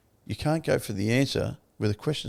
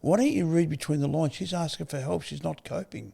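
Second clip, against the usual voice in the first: retold straight talk; 7 semitones higher than usual. Advisor explaining the wife's plea, earnest reproach. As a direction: spell it out to your mate, pitch up. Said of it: "gives it to you fucking straight".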